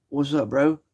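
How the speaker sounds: mock British accent, pitched high. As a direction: put on a high, exaggerated British voice. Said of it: "I was speaking in a British frickin' accent".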